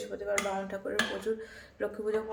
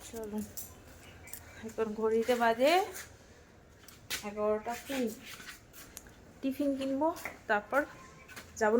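normal talking voice

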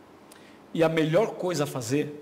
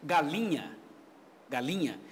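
normal talking voice

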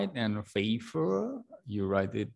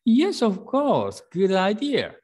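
higher and louder, a bright yes of course; cheerful agreement; 12 semitones higher, 9 dB louder than usual. Shown as a demonstration. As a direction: welcome the idea with delight. Teacher voices Uncle Ellie agreeing.

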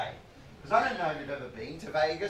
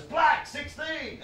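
high, slow excited yell of the number; excited shout; 10 semitones higher, 4 dB louder, much slower than usual. Retold or imitated. Barry calling out the roulette number, excited shout. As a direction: blurt it out like a thrilled man-child. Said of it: "and he goes".

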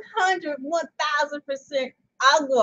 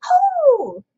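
a cracked high oh, louder; failed high note; 11 semitones higher, 8 dB louder than usual. Pretend. Singer botching the opening high note, loud and high. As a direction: reach for a high note and miss. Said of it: "I didn't hit that right".

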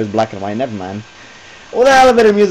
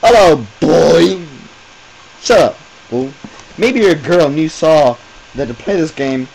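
hammed-up reading; 2 semitones higher, 7 dB louder than usual. Reading game text in voice.

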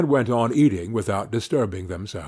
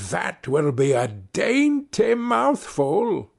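muttering witch; 6 semitones higher, slower than usual. A narrator's reading. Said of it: "she muttered to herself".

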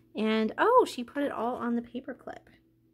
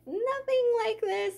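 excited; 10 semitones higher than usual. Real, not acted. Comparing own package.

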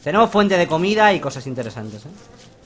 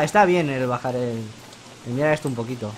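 usual speaking voice

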